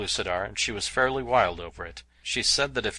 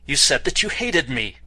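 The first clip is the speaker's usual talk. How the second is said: louder, higher cry of disbelief; cried out; 5 semitones higher, 7 dB louder than usual. A narrator's reading. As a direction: fling the accusation back at her. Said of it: "I cried".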